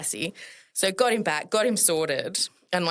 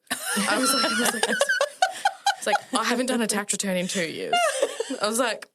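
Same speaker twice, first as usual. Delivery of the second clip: giggling through the words; laughing; 6 semitones higher than usual. Real, not acted. Nurse laughing at her panic over unpaid debt. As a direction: laugh while telling it.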